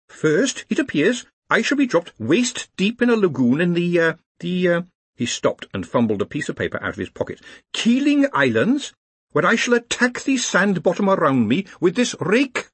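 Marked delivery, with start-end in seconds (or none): 0.1-4.8 very high-pitched academic voice; high, dutiful subordinate; prim, eager professor. Acted. Penny Smart recites task. 7.7-12.7 very high-pitched academic voice; high, dutiful subordinate; prim, eager professor. Acted. Penny Smart recites task.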